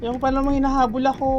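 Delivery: quick, loud and frantic; fast panicked complaint. Real, not acted. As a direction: rush it out while being chased. Being chased in game.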